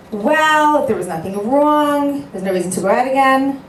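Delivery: high, loud, insistent retort to the friend. Retold or imitated. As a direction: press the point hard, mocking the advice. Retold shadchan's pushy advice.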